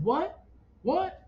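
high, rising shocked questions; retold distraught disbelief. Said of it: "he's crying".

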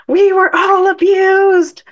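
high, loud, drawn-out mock wail; imitated tantrum. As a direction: throw a hammed-up slow tantrum. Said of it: "pounding his fists on the floor saying".

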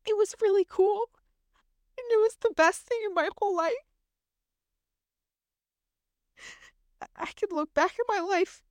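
fighting tears while telling the best moment; tearful. Real, not acted. Mother recalling her son's birth, voice breaking with tears. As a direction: voice high and breaking with held-back tears. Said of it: "And now I'm gonna cry".

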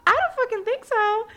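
high, loud, sassy refusal; defiant six-year-old. Retold or imitated. Six-year-old refusing to speak English, high loud sassy voice. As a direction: play a mouthy kid flatly refusing. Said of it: "I was six and I'm like".

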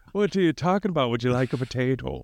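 mock Irish brogue; Irish accent. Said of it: "tell me that's not how Irish talk".

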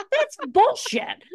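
high, loud, drawn-out mock protest; comic outrage. Retold protest, high, loud, amid laughter.

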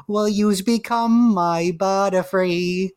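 put-on high, slow New York accent character voice; sing-song cartoon voice with a New York accent; Meowth. Co-host imitating a cartoon's favorite line, New York accent. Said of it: "Meowth speaks in a little, in a, like a, like a New York accent".

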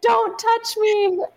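high, drawn-out mock exasperation; retold snapping. Labouring wife to hovering husband, mock exasperated warning. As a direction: imitate warning someone off, slow and high.

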